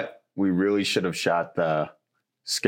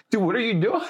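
startled; 13 semitones higher, faster than usual. Retold or imitated. Imitating Miles confronting the close talker.

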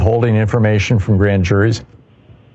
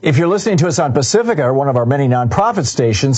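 higher, lively announcer delivery; rally listeners with a punchy, swooping pitch; upbeat station sign-off plug; radio promo read; 4 semitones higher than usual. Acted. Reads a station support promo.

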